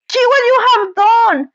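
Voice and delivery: high, shrill-edged cry of blame; mild scream. A narrator's reading. Melting witch to Dorothy, high mock scream. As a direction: scream at the girl. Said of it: "she screamed".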